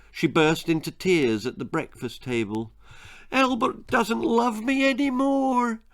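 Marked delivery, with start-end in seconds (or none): 3.3-5.8 high, tearful wail of a heartbroken mother; crying mother. A narrator's reading. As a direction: cry it out high, bursting into tears. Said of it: "she cried".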